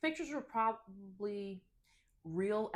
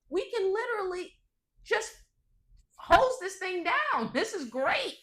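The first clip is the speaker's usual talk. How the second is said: high, loud, thrilled retelling of the moment; excited recall; 12 semitones higher, 5 dB louder, slower than usual. Retold or imitated. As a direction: burst out with delight as you remember it. Excited quote to partner about dog.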